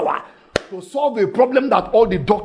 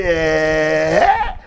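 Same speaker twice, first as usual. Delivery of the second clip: astonished eh; 4 semitones lower than usual. Acted. Woman's astonished reaction.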